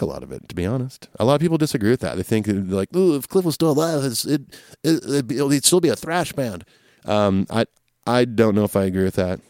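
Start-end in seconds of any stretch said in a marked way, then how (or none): 3.0-6.6 mocking fans. Mimicking fans who disagree.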